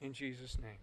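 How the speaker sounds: earnest prayer, loud then hushed. Acted. Leads congregation in prayer.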